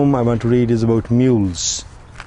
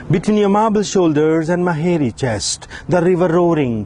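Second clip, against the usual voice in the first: higher, ringing recital, each stanza opening high; impassioned poem recital; 7 semitones higher than usual. Acted. Poet reciting his love poem to the audience, impassioned and rising. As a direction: build each Between line up high, then let the echoes fall.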